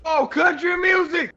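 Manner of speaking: loud, high delighted shout; excited shout. Real, not acted. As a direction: cheer at the song. Cheering at country music.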